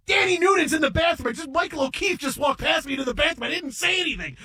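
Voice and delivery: telling his wife, flustered, high excited blurt. Retold or imitated.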